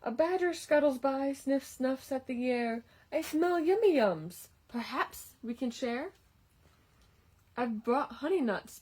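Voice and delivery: lively storybook read-aloud; reading to children. A narrator's reading. Reading a picture book to children, lively storytime voice. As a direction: read it like story time for little kids.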